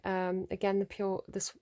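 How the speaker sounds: ordinary delivery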